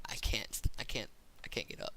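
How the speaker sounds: put-on breathless whisper from a hurt kid; imitating a whispered plea. Hurt kid says he can't stand.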